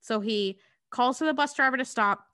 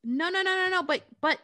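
pleading protest; 8 semitones higher, faster than usual. Retold or imitated. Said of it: "he's just like".

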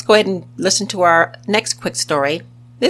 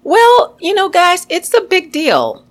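bright high-pitched big deal, raised well above her voice; high upbeat delivery; 11 semitones higher, 5 dB louder than usual. Shown as a demonstration. Telling podcast listeners about foreign accents, high upbeat voice. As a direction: pitch it way up, excited. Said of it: "Or as the British would say".

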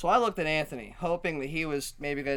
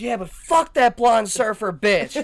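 louder, higher, laughing through an angry jab; mock drunk insult; 6 semitones higher, 9 dB louder than usual. Drunk woman insulting the storyteller, laughing mock anger. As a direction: spit the insult while cracking up. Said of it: "And she goes".

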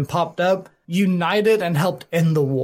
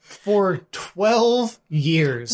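very slow, drawn-out "twelve years"; dramatic emphasis; much slower than usual. Real, not acted. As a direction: stretch it out for dramatic weight. Dramatic emphasis on war's length.

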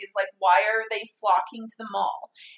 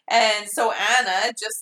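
ordinary talk

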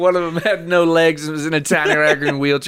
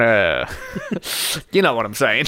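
ordinary delivery

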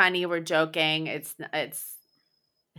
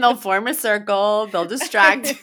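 excited joking; 7 semitones higher, 9 dB louder than usual. Real, not acted. Joking about theft crew.

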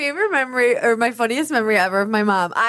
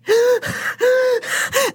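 imitating mom's high, gasping breath; breathless gasp. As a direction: gasp for air like someone who just ran in. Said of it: "all out of breath".

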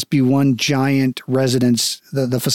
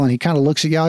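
usual speaking voice

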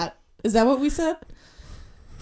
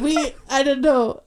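ordinary delivery

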